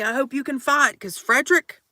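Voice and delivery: ordinary talk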